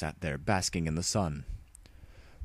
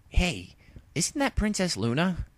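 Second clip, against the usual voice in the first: lightly voiced tomboy pony, higher; brash young pony, a touch higher; Rainbow Dash voice; 12 semitones higher than usual.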